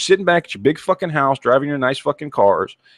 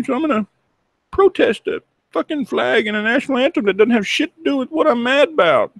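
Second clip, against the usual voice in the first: mocking spoiled athlete; 10 semitones higher than usual. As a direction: sneer at him by whining high and fake-sobbing. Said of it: "spoiled, entitled little bitches out there".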